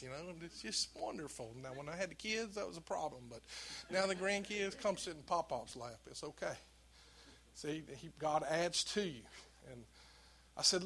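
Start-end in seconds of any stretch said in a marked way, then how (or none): none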